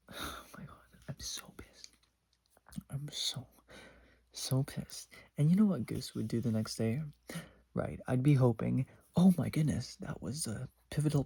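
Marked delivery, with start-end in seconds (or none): 0.1-5.1 unvoiced, seething whisper; frustrated whisper. Real, not acted. Whispering her anger about the boy to viewers. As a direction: vent it under your breath. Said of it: "whispered frustration".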